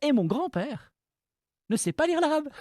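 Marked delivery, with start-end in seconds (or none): 1.7-2.6 laughing. Real, not acted. Laughing while telling anecdote.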